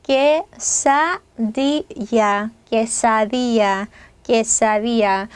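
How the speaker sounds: quesadilla said very slowly as 께사디야; Spanish quesadilla. Shown as a demonstration. Teacher drilling quesadilla slowly for the class. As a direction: spell out quesadilla sound by sound, ll as ya. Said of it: "얘는 어떻게 되겠어요?".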